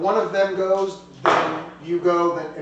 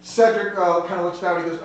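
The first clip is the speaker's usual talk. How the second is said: ordinary talk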